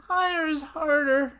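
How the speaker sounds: very high, put-on head voice. Shown as a demonstration. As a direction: place it way up high. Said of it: "like up here".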